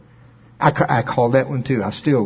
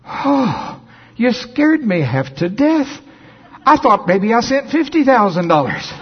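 higher, slow relieved sigh of a voice; a donor breathing out in relief; 10 semitones higher, 3 dB louder, slower than usual. Retold or imitated. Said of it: "I heard this sigh of relief".

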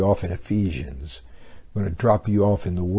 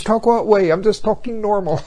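high, innocent mock English accent; a Texan turned English, puzzled in a mock English accent; 12 semitones higher, 6 dB louder than usual. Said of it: "talking like an Englishman".

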